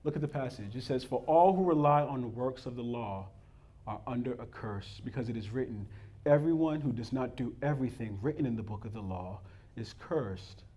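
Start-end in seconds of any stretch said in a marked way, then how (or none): 1.0-10.6 scripture reading. Acted.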